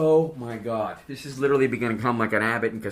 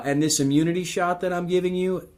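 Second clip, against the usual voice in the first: put-on higher nurse voice with a Filipino accent; 7 semitones higher, 3 dB louder than usual. Nurse giving the shot, higher light Filipino accent. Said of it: "that's a very good Filipino accent".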